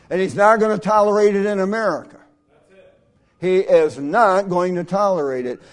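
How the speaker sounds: loud, driving sermon climax; preaching. Acted. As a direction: pound it home to the congregation. Preaching at full force.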